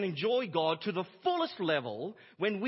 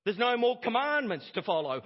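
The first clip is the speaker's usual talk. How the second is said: mock antinomian objection; 4 semitones higher, 5 dB louder than usual. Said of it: "those that say".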